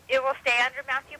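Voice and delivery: ordinary talk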